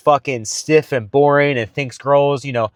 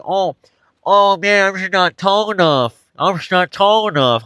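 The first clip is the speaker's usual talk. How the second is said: higher, mocking whine of self-pity; stupid whiny voice; 6 semitones higher than usual. Mocking a short guy's excuse, in a stupid whiny voice. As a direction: sneer at the belief by whining it. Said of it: "say it in a stupid fucking voice".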